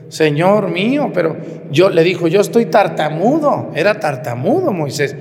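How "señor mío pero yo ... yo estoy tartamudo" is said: pleading Moses. Retold or imitated. Moses objects to God.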